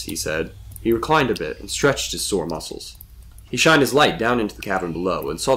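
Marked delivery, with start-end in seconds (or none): none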